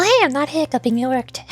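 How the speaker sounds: usual speaking voice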